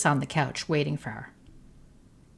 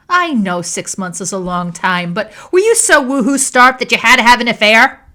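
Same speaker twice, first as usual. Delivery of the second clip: loud, higher sneering attack; louder and higher, mocking; scornful anger; 6 semitones higher, 11 dB louder than usual. A narrator's reading. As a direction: throw the accusation at her. Nina mocking wife.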